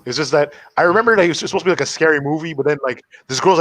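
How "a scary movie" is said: ordinary talk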